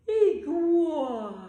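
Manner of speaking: slow, loud, high yawn. Shown as a demonstration. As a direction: stretch it into a huge yawn. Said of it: "Let's yawn iguana".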